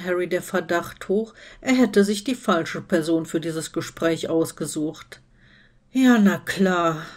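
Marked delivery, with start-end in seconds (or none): 5.9-7.0 slow, tired sarcastic reply; weary sarcasm. A narrator's reading. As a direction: drag it out wearily, unconvinced. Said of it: "erwiderte er matt". (matt und sarkastisch)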